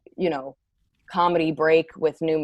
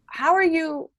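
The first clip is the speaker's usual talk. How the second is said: much higher pitch, mock reaction to a distraction; strained high reaction; 12 semitones higher than usual. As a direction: act thrown off, pitched way up. Acting out reaction to noisy attendee.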